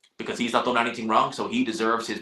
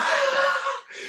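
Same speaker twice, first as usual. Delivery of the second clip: sudden squeaky laugh, far higher than his voice; high burst of laughter. Real, not acted. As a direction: crack up in a high squeal at the idea. Laughs at imagining meeting Usyk.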